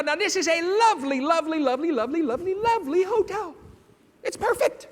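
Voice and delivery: gushing praise. Acted. Gushing over the hotel.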